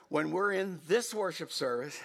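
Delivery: usual speaking voice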